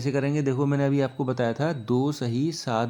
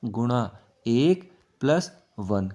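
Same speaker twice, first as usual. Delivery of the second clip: slow explaining; much slower than usual. Shown as a demonstration. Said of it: "देखो".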